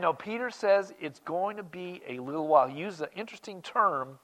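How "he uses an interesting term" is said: normal talking voice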